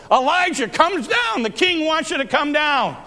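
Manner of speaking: high-pitched captain's call uphill; crying out. Retold or imitated. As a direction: call out to someone on a hilltop. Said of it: "the captain cries out to him".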